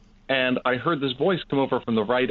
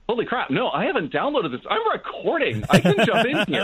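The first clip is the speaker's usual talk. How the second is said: higher and higher, a self-mocking gasp of realization; sudden realization; 9 semitones higher than usual. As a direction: pitch jumps high in mock alarm, laughing at the end. Retold moment of realizing he's recording.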